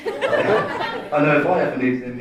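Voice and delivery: ordinary talk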